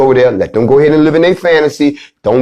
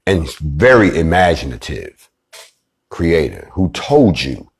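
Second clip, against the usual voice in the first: much lower, slow, grave voice-over read; low and slow like a solemn TV ad; commercial voice; 9 semitones lower, 3 dB quieter than usual. Acted. To his listeners, a lower, slow, grave commercial-style read. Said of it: "this sound like one of them damn commercials".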